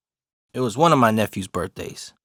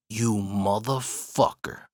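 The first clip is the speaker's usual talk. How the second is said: slow, soft, under the breath; mouthed curse; 4 semitones lower, 7 dB quieter, slower than usual. Retold or imitated. Man shaking his head at him, imitated quiet mouthed curse. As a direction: imitate silently mouthed words, drawn out. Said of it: "I can read his lips".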